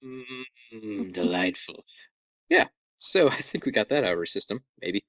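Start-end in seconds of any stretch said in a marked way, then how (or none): 0.0-1.8 low, very soft murmur; mock relish. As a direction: savour it quietly and dryly. Savouring remark after a gross joke.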